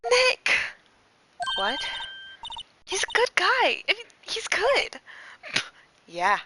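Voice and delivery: put-on loud high eager girl; high excited girl voice. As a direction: call it out high and bright.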